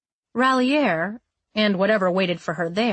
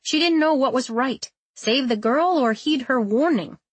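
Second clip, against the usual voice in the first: usual speaking voice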